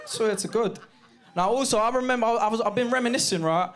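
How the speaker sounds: ordinary delivery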